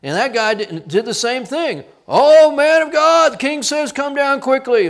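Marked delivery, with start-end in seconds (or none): 2.1-4.9 high, loud, slow mock herald call; loud commanding summons. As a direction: bark the king's order up the hill. Captain summons the prophet.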